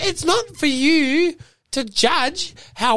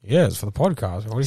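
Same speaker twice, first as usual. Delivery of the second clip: corporate spokesperson; 14 semitones lower than usual. Acted. Low corporate voice.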